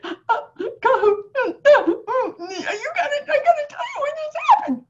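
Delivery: high, fast, breaking through tears and laughter; tearful call. Retold or imitated. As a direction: blurt out the news crying and laughing at once. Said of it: "I called you in tears".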